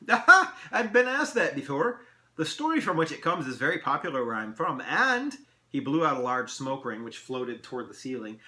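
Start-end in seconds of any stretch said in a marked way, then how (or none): 0.1-5.4 high, loud, relishing elf voice; animated elf showing off what he knows; amused elf. Acted. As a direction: answer with lively self-satisfaction. Elf answers the riddle.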